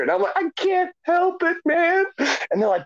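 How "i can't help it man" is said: high-pitched helpless plea; helpless protest, retold. Caterer to coworkers at a funeral, high helpless protest.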